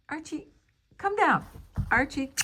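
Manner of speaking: sharp, loud high call; calling out sharply. Real, not acted. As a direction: order the cat down sharply. Calling the cat down.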